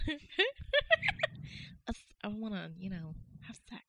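Ladies' Man impression. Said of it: "the Saturday Night Live guy".

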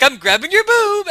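high, slow pull-string toy line; talking doll voice. Pretend. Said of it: "the Logan doll would say when you pull the string".

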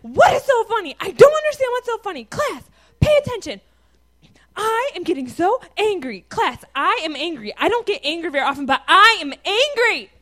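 high, loud, hammed-up scolding; freaking-out angry teacher. Student mimicking a teacher scolding a giggling class, hammed-up fury. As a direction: imitate an exasperated teacher losing control.

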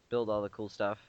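normal talking voice

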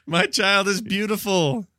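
slow, high, hammed-up doting parent; doting proud parent. Mocking the director who praises his own game, in a gushing parent voice. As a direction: gush it like a mock-proud parent. Mock defensive parent analogy.

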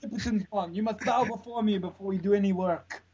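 David Cage megalomaniac. Pretend. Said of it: "he seems like this sort of omnipotent".

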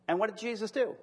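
ordinary delivery

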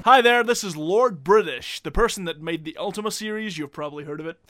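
lightly put-on British accent; a touch of posh British. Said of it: "That is one classy British accent you've got there".

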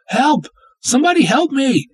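high, loud desperate scream; screaming for help. Acted. Tied-up woman screaming for rescue, desperate. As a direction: scream for anyone to hear. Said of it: "Scream all you want".

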